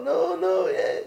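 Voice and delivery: imitated guy-to-guy banter; talking to the chevre. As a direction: toss it off like chatter with the guys. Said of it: "whenever you talk with your chevre".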